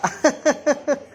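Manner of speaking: high-pitched burst of giggles; high giggling laugh. Real, not acted. Dad amused by the child, high giggling laugh. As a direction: giggle high in a rapid run.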